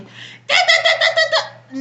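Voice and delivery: high mock "da da da" babble; mock nagging. Mimicking mom's constant nagging.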